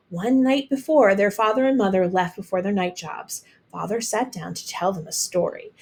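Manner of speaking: ordinary delivery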